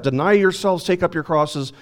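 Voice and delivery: normal talking voice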